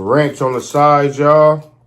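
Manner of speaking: loud, hyped food presenter call. Real, not acted. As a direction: belt out the y'all to the viewers. Mukbang host hyping the food.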